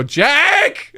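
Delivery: high, drawn-out retold call. Student calling roommate who took the ladder, calling out. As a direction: imitate hollering for a roommate.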